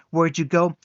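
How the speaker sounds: loud call across the room. Acted. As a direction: shout it toward the back room. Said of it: "he called into the diner".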